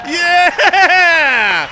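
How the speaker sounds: loud triumphant yell; excited cheer. Real, not acted. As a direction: cheer the win loudly. Celebrating a win.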